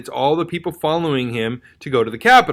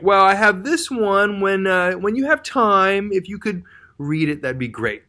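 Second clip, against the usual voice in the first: humble; 4 semitones higher than usual. Retold or imitated. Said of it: "one's kind of being very humble".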